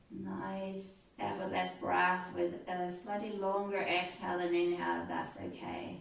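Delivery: right in your ear. Acted. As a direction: ease them down gently, close and soft. Yoga cool-down, eyes closed.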